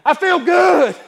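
building from slow and level to loud, high joy; new convert rejoicing that his guilt is gone; joyful release. Retold or imitated. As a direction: let the relief swell into a shout. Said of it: "and I just thought".

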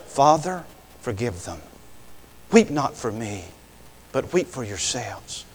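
low, soft plea with pauses; hushed, solemn quotation. Acted. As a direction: drop down low and gentle after the jeers. Quotes Jesus against the mockers.

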